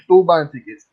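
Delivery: ordinary talk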